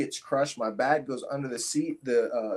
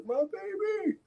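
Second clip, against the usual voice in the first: much higher mock-crying mother's voice; tearful mom; slower than usual. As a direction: wail it like his mom over her son. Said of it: "my mom's crying".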